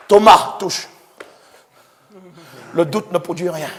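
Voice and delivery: ordinary talk, nothing marked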